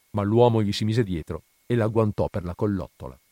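plain reading voice

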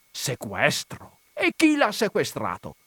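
slow echo of the word rising into a high cry; Sequestro? turned into an amazed, high outcry; startled exclamation. Acted. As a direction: catch the word, then burst out high. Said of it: "esclamò lo Zirafa".

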